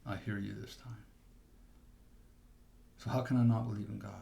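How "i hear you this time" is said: quiet surrender. Retold or imitated. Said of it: "I said".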